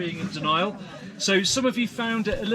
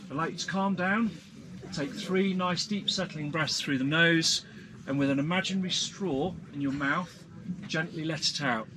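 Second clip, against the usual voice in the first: guided breathing; 3 semitones lower than usual. Acted. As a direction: talk them gently into calm. Leads a breathing exercise.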